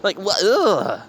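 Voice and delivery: imitated high-pitched recoil in disgust. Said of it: "like I was disgusted".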